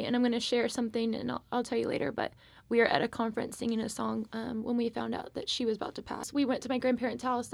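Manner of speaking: ordinary talk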